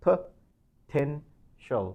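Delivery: Potential drawn out very slowly, stress on the second syllable. Shown as a demonstration. Teacher slowing Potential for learners to copy. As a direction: stretch Potential slowly and lean on the middle. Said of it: "you need to stress the second syllable".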